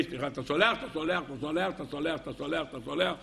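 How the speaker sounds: low, soft mumbled chant, repeated by rote; mechanical apology ritual. Acted. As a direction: mimic schoolkids running down the line asking forgiveness mechanically. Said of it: "היד עבדה ככה". (מלמול סליחה מכני וחדגוני)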